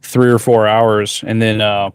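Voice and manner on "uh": ordinary talk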